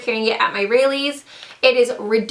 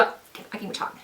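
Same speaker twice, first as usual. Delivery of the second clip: whispered aside; 2 semitones lower, 13 dB quieter than usual. Real, not acted. Frustrated aside after stumbling.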